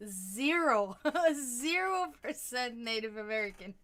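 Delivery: real amused laugh while saying it. Real, not acted. Laughs at her own ancestry result.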